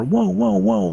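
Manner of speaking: high, loud panicked whoas; repeated frantic high whoa shouts; loud alarmed yelling. Retold or imitated. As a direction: yell whoa over and over in alarm. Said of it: "like that, really loud".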